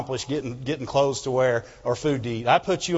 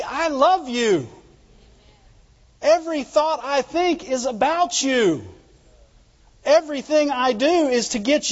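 high, louder pulpit fervour; preaching at full force; 10 semitones higher, 3 dB louder than usual. Acted. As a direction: lift it up and preach God's promise. Preacher voicing God's reassurance.

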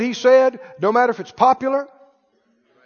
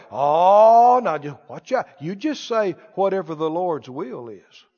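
pious objector; 6 semitones lower than usual. Acted. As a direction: drop into a meek, cautioning religious voice. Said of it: "they're taught not to talk like that".

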